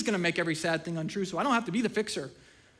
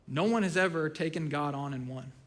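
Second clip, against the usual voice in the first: ordinary talk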